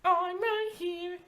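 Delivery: very high, slow singsong creature voice; playful creature. Acted. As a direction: squeak it out like a creepy toy. Game master answers as Runcible.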